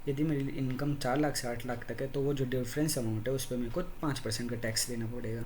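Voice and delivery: ordinary delivery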